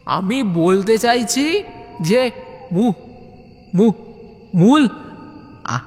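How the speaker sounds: stuttering through the word; stammering question. Acted. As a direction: struggle to get the word out. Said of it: "আবার মুমু করে". (তোতলাতে তোতলাতে প্রশ্ন)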